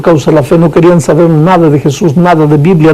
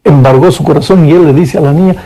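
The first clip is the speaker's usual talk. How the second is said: ordinary talk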